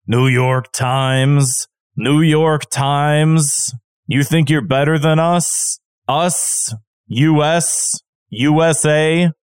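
dragged out like a coach at open mic; slam poetry. Retold or imitated. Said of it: "an open mic slam poetry".

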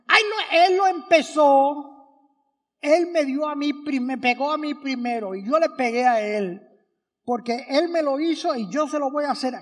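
put-on loud, high, protesting kid voice; child defending himself to the adult breaking up the fight, loud and whiny; whiny child excuses. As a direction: play a kid caught fighting who blames the other. Quarreling kids make excuses to adult.

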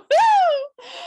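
loud, high delighted laugh; high burst of laughter. Real, not acted. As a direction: crack up laughing. Laughs at the line read.

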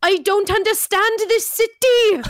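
high, loud, slow Welsh wail; Cardiff accent. Retold or imitated. Cardiff newcomer overwhelmed by London, Welsh accent. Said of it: "my friend who was from Cardiff".

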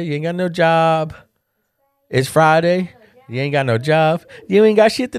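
hamming up a lightly voiced Smokey; quoting Smokey. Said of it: "quoted a quote from Smokey".

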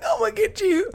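very high mock-crying whine; weeping threat. Crying friend at best friend who won't answer, weeping threat. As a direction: squeak the threat through pretend tears. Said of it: "I'm still weeping tears".

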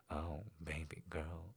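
low, soft and moaned. A narrator's reading. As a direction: let it out as a hushed moan. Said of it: "he moaned".

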